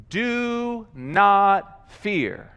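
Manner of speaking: very slow, word by word; solemn Moses. Acted. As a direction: space out each word with weight. Moses addressing the people, read slowly.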